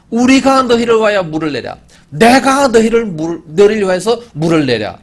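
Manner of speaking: loud, proud shout, high and slowing; arrogant shout. Acted. Moses shouting proudly at the grumbling people, loud and arrogant. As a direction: bellow it like a proud leader striking out.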